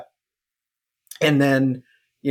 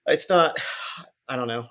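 ordinary delivery

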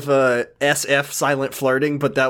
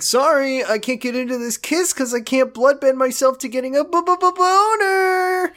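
high, stammering mock voice for the boyfriend; mocking Josh; 11 semitones higher than usual. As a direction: parody him in a squeaky stuttering voice. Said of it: "and he's like".

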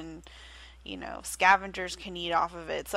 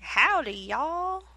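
Southern drawl, a playful cowboy greeting; hammed-up Southern twang, louder and higher; mock Southern accent; 7 semitones higher, 5 dB louder, much slower than usual. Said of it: "like, southern orcas".

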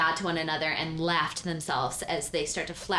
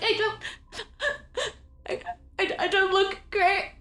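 much higher, tearful, hurt disbelief; a wounded sob at the insult; hurt crying squeak; 13 semitones higher, 3 dB louder than usual. Acted. Trinity hears she looks bad, tearful squeak.

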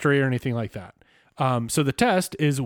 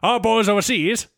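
mid-Atlantic accent; 3 semitones higher than usual. Shown as a demonstration. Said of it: "I'm going mid-Atlantic".

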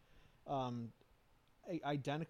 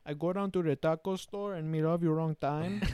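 mock Mexican accent; 4 semitones higher, 5 dB louder than usual. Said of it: "Mexican accents".

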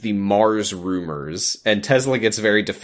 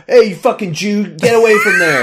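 sudden high, loud yell at a stranger; 10 semitones higher, 7 dB louder than usual. Retold or imitated. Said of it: "yells at some guy".

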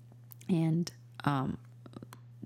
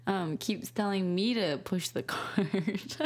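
ordinary talk, nothing marked

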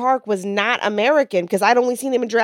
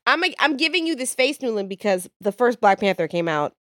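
normal talking voice

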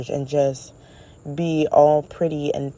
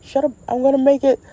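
cocky brush-off; 9 semitones higher, 4 dB louder, faster than usual. Retold or imitated. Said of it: "He's like".

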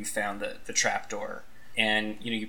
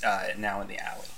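ordinary delivery

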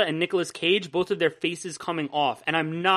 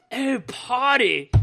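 slow, higher mock-British voice; exaggerated snooty British accent; pretentious British accent; 5 semitones higher, much slower than usual. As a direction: stretch it out like a pompous Brit. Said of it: "sounds like some pretentious British person".

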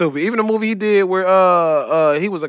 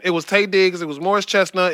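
usual speaking voice

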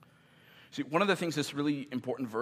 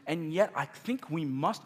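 normal talking voice

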